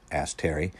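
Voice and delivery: plain reading voice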